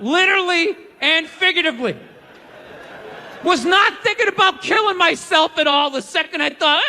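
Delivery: ordinary talk, nothing marked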